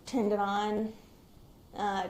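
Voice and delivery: ordinary talk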